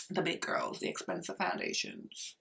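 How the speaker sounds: breathy whisper under her breath; a sly whispered aside. Real, not acted. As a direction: drop to a secret whisper. Aside about pricey brands.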